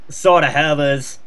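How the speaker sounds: normal talking voice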